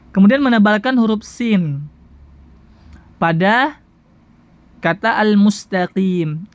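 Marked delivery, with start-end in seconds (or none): none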